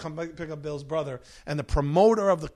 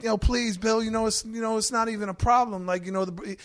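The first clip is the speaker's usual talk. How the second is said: higher, pleading sing-song; pleading brother; 6 semitones higher than usual. Retold or imitated. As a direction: coax Bill, half begging.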